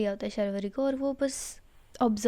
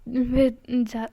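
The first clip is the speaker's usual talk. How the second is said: usual speaking voice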